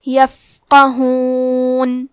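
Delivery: یفقہون drawn out very slowly, the فا kept silent and clear; slow recitation demo. Shown as a demonstration. Teacher reciting یفقہون slowly for students. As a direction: chant یفقہون slowly, letter by letter. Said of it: "ہمیں فا کو ہمزے سے پڑھنا ہے".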